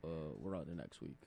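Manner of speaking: low, soft monotone; mumbled, emotionless press answer; Bill Belichick. Acted. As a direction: drone it like a robot coach. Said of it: "He talks so mo-, monotone".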